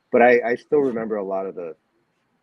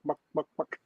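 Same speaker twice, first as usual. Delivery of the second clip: chicken clucking; 7 semitones higher, 6 dB quieter than usual. Shown as a demonstration. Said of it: "a rhythm that goes like buck".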